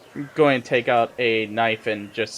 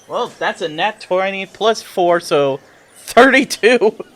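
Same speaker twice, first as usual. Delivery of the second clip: higher voice, building louder to the total; excited boast; 6 semitones higher, 7 dB louder than usual. Real, not acted. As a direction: stretch out the final number in triumph. Announces a huge dice roll.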